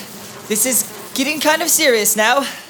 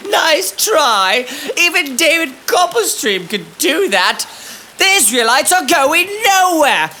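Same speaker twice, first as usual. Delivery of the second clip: higher, scornful brush-off; mocking taunt; 6 semitones higher than usual. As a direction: sneer at his threat. Pharaoh taunting Moses.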